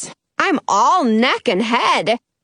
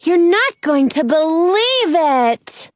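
slow, higher excited call; calling from above; 4 semitones higher than usual. A narrator's reading. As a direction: draw out the big news from up high. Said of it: "Penny's voice comes from way above us".

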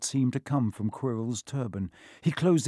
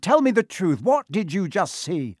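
loud, high demand; shouting; 6 semitones higher, 5 dB louder than usual. A narrator's reading. Quirrell demands the truth from Harry, shouting. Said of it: "Quirrell shouted".

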